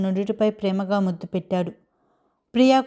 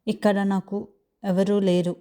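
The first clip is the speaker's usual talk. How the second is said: ordinary delivery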